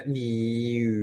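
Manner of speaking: "niu" drawn out, an i said through o-rounded lips; rounded i sound. Shown as a demonstration. Teacher showing how to read it, "niu" slowly with rounded lips. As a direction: stretch "niu", shaping i with a round mouth. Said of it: "mulutnya di kayak o".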